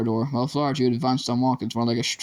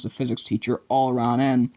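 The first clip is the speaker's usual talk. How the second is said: plain reading voice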